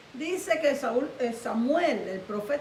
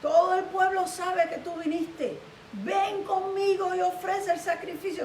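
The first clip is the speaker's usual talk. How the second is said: high, insistent begging; pleading; 6 semitones higher than usual. Retold or imitated. Saúl asks Samuel to come along, pleading. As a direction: implore him to come along, pitched up. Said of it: "dice la Biblia que le rogó".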